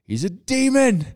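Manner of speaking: high, slow mock outrage; mocking accuser voice. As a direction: ham up the scandalized leaders. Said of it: "when they see Jesus".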